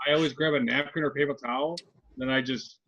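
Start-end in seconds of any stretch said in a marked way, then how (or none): none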